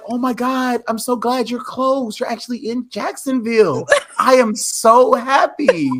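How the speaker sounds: high-pitched mimicry of a thrilled relative; Anna's gushing. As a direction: gush in a put-on high voice. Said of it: "that's how she said it".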